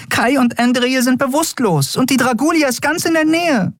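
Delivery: high, anxious little voice; anxious fairy. Acted. Nelen warns Keru about the Dragulia, high anxious. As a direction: rattle off the danger, worried.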